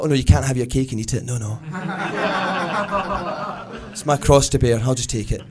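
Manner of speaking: hammed-up noble suffering; mock martyrdom. To Marion about the cake, hammed-up noble suffering. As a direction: bear it like a cross.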